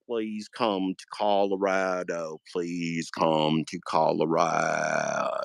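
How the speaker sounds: low, slow drawn-out plea. Retold or imitated. Mock podcaster begging viewers, low and slow. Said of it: "that was my Steve Johnson impression".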